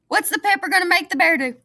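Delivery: ordinary delivery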